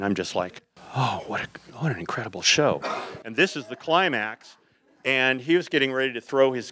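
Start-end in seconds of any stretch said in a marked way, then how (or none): 1.0-2.8 breathless, whispery start of mock wonder; tripping, awestruck. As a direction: act out being dazed by the show. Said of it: "now I'm really tripping".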